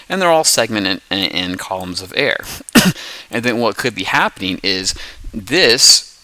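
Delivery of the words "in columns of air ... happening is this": ordinary delivery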